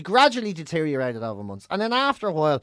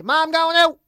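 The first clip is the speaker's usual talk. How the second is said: high, loud shout to mother; imitated child's call to Ma; kid calling out; 10 semitones higher, 6 dB louder than usual. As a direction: holler it through the house on leaving. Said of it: "you'd be leaving the house".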